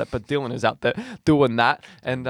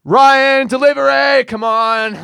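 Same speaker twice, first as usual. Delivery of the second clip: much higher and louder bossy call; bossy mum shout; 12 semitones higher, 12 dB louder, much slower than usual. Retold or imitated. New coworker bossing him to a delivery, loud and high. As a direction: yell the name across the kitchen. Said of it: "I hear this woman, and she goes".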